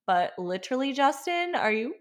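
pleading, incredulous call to a husband; can't believe he's doing this; exasperated disbelief. Retold or imitated. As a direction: voice jumping high on the husband's name. Exasperated address to absent husband.

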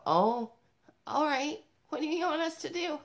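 high-pitched mock kid voices; reluctant kids, pitched well up; kids giving in. Kids answering Mom's new rule, mock high-pitched resignation. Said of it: "They go".

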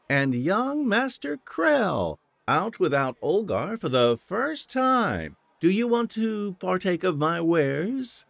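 high, slow flirty tavern hostess; coy barmaid teasing a shy lad; teasing innkeeper woman.

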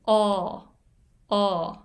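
"O" held open and steady, mouth not moving; demo vowel of ball. Shown as a demonstration. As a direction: say "O" as an open aw with no glide. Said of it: "口は動かさない". (「O」を口を動かさず開いたまま)

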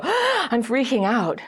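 gasp, then high, drawn-out alarm; acted freak-out after a sharp gasp; sudden panic. Dater suddenly hit by attachment wound, acted panic. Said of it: "and you suddenly go".

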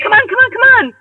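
high, eager come-on urging. Retold or imitated. Urging her partner into the backyard, eagerly. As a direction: hurry him along, bouncing with glee. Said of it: "I was like".

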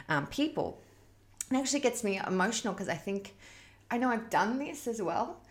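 usual speaking voice

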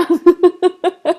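a real burst of high laughter; short loud giggle; bright laugh. Real, not acted. Laughs after the climbing story.

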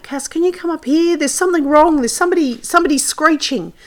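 high-pitched urgent call across the paddock; retold yell. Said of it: "I heard him yell out".

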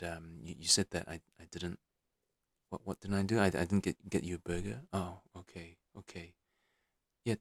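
gentle reassuring dad; soft, gentle tone. Acted. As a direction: invite him to open up, quietly. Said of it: "soften your voice, soften your tone".

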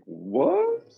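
high, stunned "What?"; disbelieving squeak; shocked what. Retold or imitated. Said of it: "I'm like".

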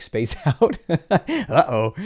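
laughing through a sheepish uh-oh; light, giggly oops; amused self-mockery. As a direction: chuckle at your own imagined slip. Mocks own lapse, laughing.

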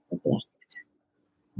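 slow, dropped to a whisper; slow hushed warning. Real, not acted. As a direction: let block it fall away into breath. Ominous contrast, bless or block.

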